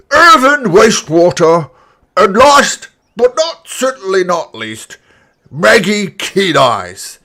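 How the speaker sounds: put-on booming old orc addressing the crowd; grand proclamation, slow and loud; elderly orc announcer.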